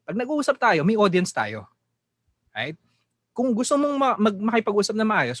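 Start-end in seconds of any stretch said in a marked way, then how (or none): none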